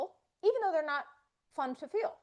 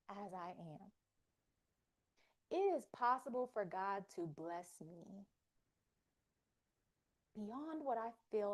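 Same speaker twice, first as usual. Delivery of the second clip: guided affirmation; 8 dB quieter than usual. Acted. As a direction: lead them gently, word by word. Leading affirmations.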